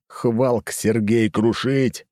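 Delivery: slow, heavy smash-talk; brute monster voice. Acted. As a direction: speak like a raging brute. Berserker smashing, Hulk-style.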